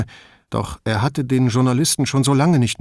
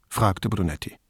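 plain reading voice

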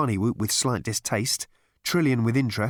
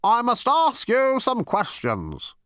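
high, slow, officious demand; pompous, deliberate interrogator; stiff insistence; 12 semitones higher, 3 dB louder, slower than usual. A narrator's reading. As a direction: spell it out slowly at a raised pitch. Zarniwoop begins stiff interrogation.

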